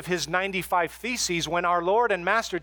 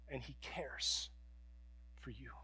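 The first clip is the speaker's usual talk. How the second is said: whisper; 6 semitones lower, 19 dB quieter than usual. Real, not acted. Tender close of sermon point.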